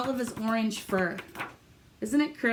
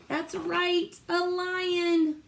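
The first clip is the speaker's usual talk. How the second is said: excited praise; 7 semitones higher, much slower than usual. Real, not acted. Praising kids' answer.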